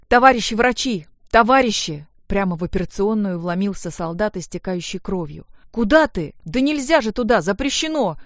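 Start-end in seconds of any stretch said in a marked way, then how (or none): 0.1-2.1 loud, raised cry to the doctors; urgent call for help. A narrator's reading. Soldier bursts into operating room. 5.7-8.2 higher, louder alarmed protest; urgent raised voice forbidding entry; nurse's shout. A narrator's reading. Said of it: "закричала медсестра Ирина".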